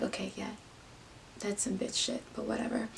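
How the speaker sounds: very soft grumble under the breath; resigned muttering. Retold or imitated. Her left in the car, quiet resigned grumble.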